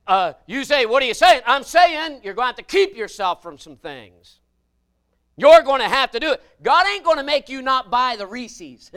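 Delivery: loud, high preacher's holler; full-force preaching. Acted. Preacher warning the congregation about temptation, hollering. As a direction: drive it home to the pews.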